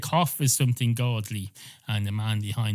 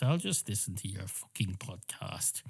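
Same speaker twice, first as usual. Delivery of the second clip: unvoiced, soft sarcastic whisper; whispered put-down; 5 semitones lower, 8 dB quieter than usual. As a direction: whisper the jab under your breath. Barman's muttered put-down.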